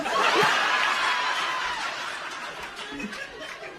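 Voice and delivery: real high laughing fit; high burst of laughter. Real, not acted. Teller laughing at own joke before punchline.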